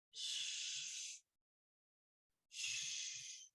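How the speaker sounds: long, very soft shushing; whispering wind. A narrator's reading. Reader imitating wind in the leaves, soft whispery shush. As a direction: hush like wind through leaves. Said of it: "It whispers".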